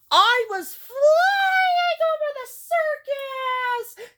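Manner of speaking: shouted at full height; excited shout. Acted. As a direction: cry out from up in the air, long and high. Acted tale of flying over circus.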